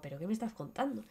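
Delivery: soft, fast disbelieving mutter; a touch of stunned mother; baffled mumble. Retold or imitated.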